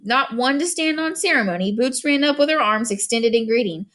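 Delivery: plain reading voice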